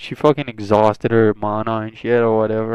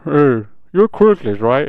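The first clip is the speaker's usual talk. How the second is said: mouth full; 3 semitones higher, 6 dB louder than usual. Acted. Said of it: "with still food in her mouth".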